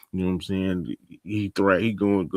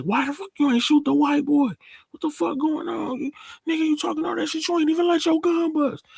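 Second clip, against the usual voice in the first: high-pitched, agitated imitation of a row; mock outraged confrontation; faster than usual. Kane storming out and confronting Tariq, high-pitched mock outrage. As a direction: tear into him in a strained high voice.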